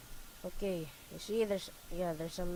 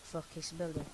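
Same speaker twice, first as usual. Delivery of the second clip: usual speaking voice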